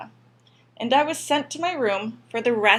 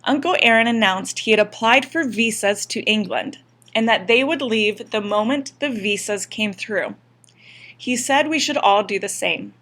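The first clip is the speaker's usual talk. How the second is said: plain reading voice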